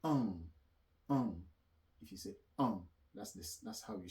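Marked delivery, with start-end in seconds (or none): 0.0-1.4 "um" as a nasal "on", no closed m; French nasal "an". Shown as a demonstration. Teacher introduces the sound to learners, French nasal "an". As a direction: say "um" as French "an", louder and separate. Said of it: "We have the sounds".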